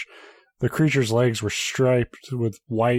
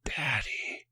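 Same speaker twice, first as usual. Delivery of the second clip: a thin, airy, far softer hiss like wind in dead leaves; 4 semitones higher, 10 dB quieter than usual. A narrator's reading. Spider girl hissing to her father, dry and ghostly. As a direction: breathe the word out like dry leaves. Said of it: "In a voice that sounded like wind in dead leaves, she hissed".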